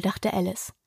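ordinary delivery

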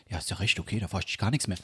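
low, soft, huffy dad; imitated Hessian father. Father giving up on asking his son, low imitated Hessian mumble. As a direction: back down quietly in Dad's low voice. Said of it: "andere Personen auf Hessisch nachmachst".

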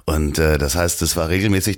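normal talking voice

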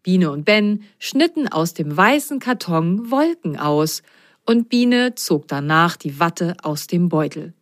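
ordinary delivery

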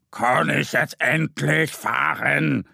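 slow, dragging boar voice; sluggish boar. Acted. Boar asking the ants if it may finally go, sluggish. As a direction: drag it out lazily. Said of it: "fragt das Wildschwein träge".

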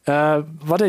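ordinary delivery

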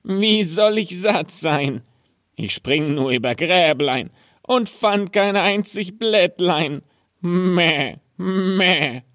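high, bleating goat voice, drawing out the Meh. Acted. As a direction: play the cheeky goat bleating back. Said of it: "und rief". (hohe, meckernde Ziegenstimme)

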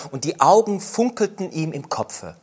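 ordinary delivery, nothing marked

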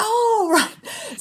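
high, drawn-out retold 'oh' of sudden understanding; lightly acted dawning realisation; mock realisation. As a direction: imitate the penny dropping, pitched high. Friend corrects him, penny drops.

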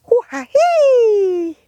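high breathy squeaky worm whisper; a tiny creature's airy squeak; worm noise. Acted. Worm speaks.

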